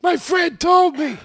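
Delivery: high, slow, laughing put-on voice; laughing mock reply. Real, not acted. Joe playing the stubborn arguer, laughing through it. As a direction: giggle the words out in a silly voice.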